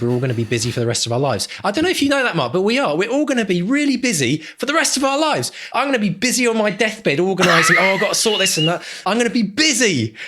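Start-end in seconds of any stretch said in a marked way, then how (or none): 1.6-10.2 worked-up rant. Real, not acted. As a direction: rant at your co-host about being busy, voice soaring. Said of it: "I'm gonna start yelling on the podcast".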